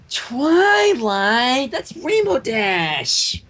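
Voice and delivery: high, slow, exasperated; slow stressed disbelief; exasperated Pinkie. A narrator's reading. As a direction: drag it out in disbelief.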